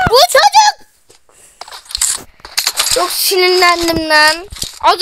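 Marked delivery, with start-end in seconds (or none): 0.1-0.9 exasperated shriek. Real, not acted. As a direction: shriek it in disbelief. Frustrated at opponent. (sinirli çığlık)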